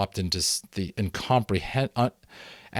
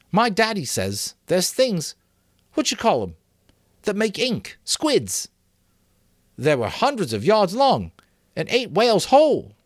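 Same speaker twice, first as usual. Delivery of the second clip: higher, earnest schoolboy voice; boy Maurice reasoning; 12 semitones higher, 5 dB louder than usual. A narrator's reading. Maurice reasoning with the assembly, higher boyish voice. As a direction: talk it through like an anxious boy.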